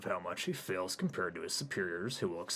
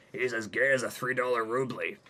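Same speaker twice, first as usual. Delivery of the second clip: mocking; 5 semitones higher, 9 dB louder than usual. [name] mocking Hayden.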